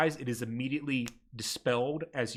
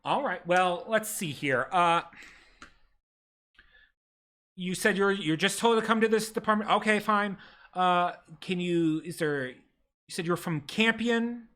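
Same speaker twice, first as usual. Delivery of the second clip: desk clerk; 3 semitones higher than usual. Acted. Ministry clerk greets the ragged party, primly bureaucratic. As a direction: recite the rules like a tired official. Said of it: "She'll say".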